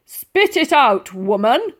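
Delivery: an irritated soldier losing patience; loud slow gruff demand; impatient bark. A narrator's reading. Sergeant major impatient.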